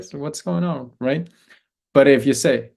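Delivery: ordinary talk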